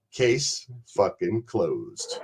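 low, quiet, very slow pronouncement; final verdict. Retold or imitated. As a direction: drop it down and draw out each word. Quotes friend's catchphrase as final verdict.

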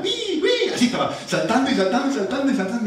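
very high squeal, fast; imitating excited daughter. Father imitating his daughter jumping around, high and fast. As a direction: bounce with childish glee.

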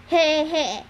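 a dim henchman's short chortle; stupid grin. A narrator's reading. Henchman told to take prisoners below, grinning stupidly. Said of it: "Orius grinned stupidly".

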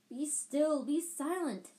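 high, loud shriek; shrieking wizard. A narrator's reading. Wizard shrieking at the noisy pot, high and loud. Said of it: "shrieked the wizard".

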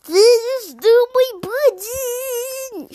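high, loud, drawn-out toad shout; hammed-up furious toad yell. A narrator's reading. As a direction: shout each word slowly in frustration. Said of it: "shouted Toad".

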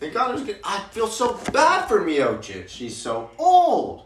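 excited. Real, not acted. Excited fight talk, laughing.

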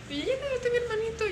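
put-on high, quick child voice; little girl's voice. Babysitter voicing the girl woken by her baby brother, high childish voice.

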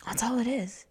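ordinary delivery

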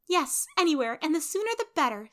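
high-pitched, decided answer. A narrator's reading. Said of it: "said Amy decidedly".

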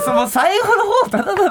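laughing. Real, not acted. Laughing while talking.

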